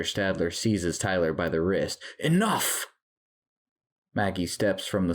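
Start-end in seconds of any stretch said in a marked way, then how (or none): 2.2-2.9 very high, forceful outburst; sharp command. A narrator's reading. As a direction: cut the fight off at once. Doctor seizes Tyler to stop fight.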